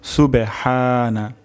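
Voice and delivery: "সুবহানা" slowly, long haa from mid-throat. Shown as a demonstration. Teacher modelling the word for learners, "সুবহানা" with drawn-out throaty haa. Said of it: "হা উচ্চারণ হবে গলার মাঝখান থেকে".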